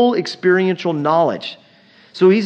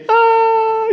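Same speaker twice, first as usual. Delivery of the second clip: much higher, louder imitated scream; kids screaming; 6 dB louder than usual. Kids flying down the water slide, imitated scream. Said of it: "you'd hear these kids".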